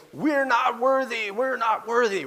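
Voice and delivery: high, drawn-out mock worship chant; Wayne and Garth. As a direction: chant it slowly in comic awe. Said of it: "as Wayne and Garth say".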